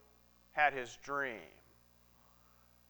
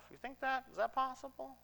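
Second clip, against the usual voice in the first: much higher and softer, almost to himself; hushed wonder; 10 semitones higher, 8 dB quieter than usual. Acted. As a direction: ask it in a small, awed hush. Wondering about brothers' forgiveness.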